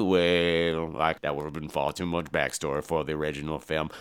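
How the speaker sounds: low, soft storyteller's rumble; deep Tony Todd voice. Retold or imitated. Tony Todd's character tells the backstory, low and soft. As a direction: drop way down and spin the tale low.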